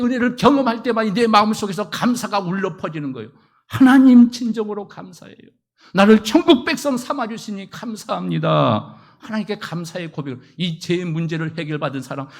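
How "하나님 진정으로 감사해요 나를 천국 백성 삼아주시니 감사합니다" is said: loud opening, heartfelt thanks to God; fervent prayer. Retold or imitated. Saved believer thanking God, fervent and loud. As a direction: pour out sincere gratitude.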